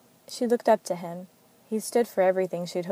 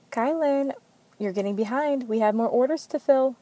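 higher, louder hurried call to a coworker; yelled; 5 semitones higher, 5 dB louder than usual. A narrator's reading. Anna Marie yelling at Kylan to keep up, loud and high. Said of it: "Anna Marie yelled at Kylan".

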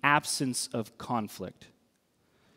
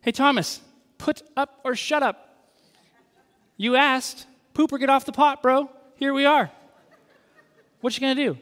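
higher, louder, teasing bro challenge; brash buddy Jesus; 8 semitones higher, 4 dB louder, faster than usual. Retold or imitated. Jesus daring Thomas to put up or shut up, brash. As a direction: call his bluff with a grin. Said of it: "What's he saying?".

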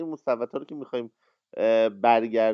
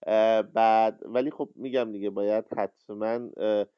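ordinary delivery

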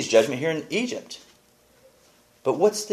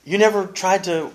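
higher, louder mock confrontation; confronting; 8 semitones higher, 5 dB louder than usual. Elaine confronting Putty at the episode's end, higher and louder. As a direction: throw it at him as an accusation. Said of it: "she confronts him on it".

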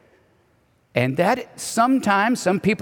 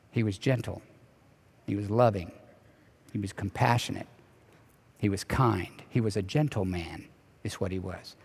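much softer and lower, almost hushed; tender hush; 9 semitones lower, 9 dB quieter than usual. Shown as a demonstration. Pastor describing gentle Jesus to the congregation, hushed and tender. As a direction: linger softly on each quality of Jesus.

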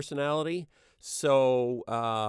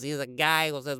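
ordinary talk, nothing marked

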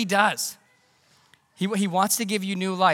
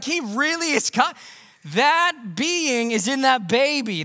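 high, loud, slowing with wonder; marvelling angels; 7 semitones higher, 4 dB louder than usual. Retold or imitated. Angels staring at the manger, high and awed. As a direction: stretch it out in amazement.

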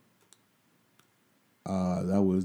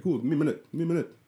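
higher, nervous stalling; 7 semitones higher, much faster than usual. Retold or imitated. Stalling the inspector.

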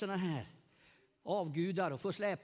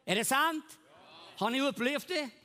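preaching at full force; 7 semitones higher, 6 dB louder than usual. Acted. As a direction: preach it out to the whole hall, lifting each word.

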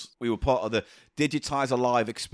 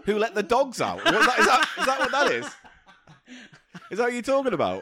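high, giggling, loud disbelief; laughing; 11 semitones higher, 3 dB louder, faster than usual. Real, not acted. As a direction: crack up mid-question. Laughing riff on Boohoo.

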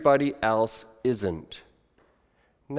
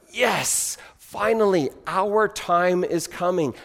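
higher, relieved yes; acting a crowd's long-awaited excitement; eager, drawn-out anticipation; excited crowd; 4 semitones higher, slower than usual. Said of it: "they got excited".

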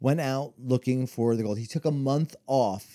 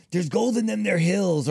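cartoon prospector; 6 semitones higher, 3 dB louder than usual. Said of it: "cartoons where it was".

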